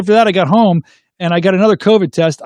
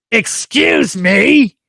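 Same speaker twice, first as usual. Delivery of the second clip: drawn-out, higher mock-indignant voice; yelling woman; 5 semitones higher, much slower than usual. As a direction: imitate an angry stranger stretching out every word. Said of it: "like, yelling at me".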